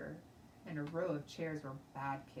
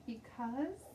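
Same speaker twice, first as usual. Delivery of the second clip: kind of scared; 7 semitones higher than usual. A narrator's reading. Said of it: "I said, kind of scared".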